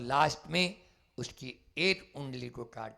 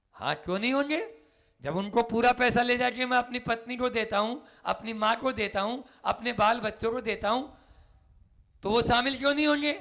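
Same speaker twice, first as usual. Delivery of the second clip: higher, louder and fast; defiant shout; 9 semitones higher, 6 dB louder, faster than usual. Acted. Bandit insisting to the Buddha, loud and fast. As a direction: fire back in a rush.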